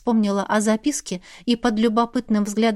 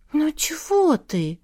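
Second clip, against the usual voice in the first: slow, higher, concerned question; worried tenderness; 5 semitones higher, slower than usual. A narrator's reading. Sister comforting the heroine, worried and tender. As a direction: ask with drawn-out, tender worry. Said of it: "обеспокоенно взяла она в ладони моё лицо".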